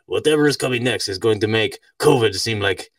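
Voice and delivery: put-on makeshift Russian-style accent; deliberate, vaguely Russian accented quote; rough Russian accent. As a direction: do Klaus Schwab in a loose Russian accent. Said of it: "I said that's kind of a Russian accent".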